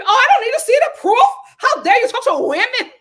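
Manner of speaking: very high, loud mock indignation; hammed-up parody of an outraged woman; mock Alexia. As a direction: shriek it in comic offence. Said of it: "I'm working on my Alexia".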